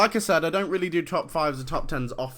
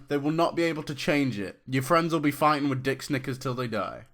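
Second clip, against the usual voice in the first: ordinary talk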